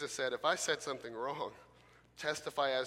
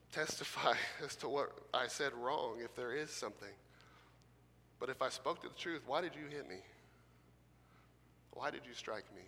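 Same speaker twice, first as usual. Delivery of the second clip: gentle Jesus; 2 semitones lower, 7 dB quieter than usual. Shown as a demonstration. Right tone demo, Jesus gently answering the man who struck him. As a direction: answer in truth gently, without anger. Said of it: "we see this tone of Jesus".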